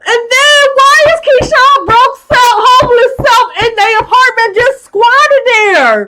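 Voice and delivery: very high, loud outrage; indignant outburst. Real, not acted. Recapper outraged at a man squatting in the apartment, indignant. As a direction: launch in, scandalized.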